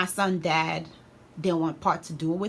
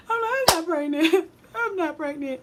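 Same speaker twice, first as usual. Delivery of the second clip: very high, drawn-out comic wail; exaggerated mock crying; 11 semitones higher, 4 dB louder than usual. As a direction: bawl it slowly in a squeal, hamming up the tears. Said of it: "I'm just crying".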